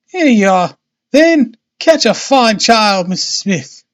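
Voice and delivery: high voice of Missus Smith, by mistake; wrong character's voice. A narrator's reading. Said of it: "that was supposed to be him".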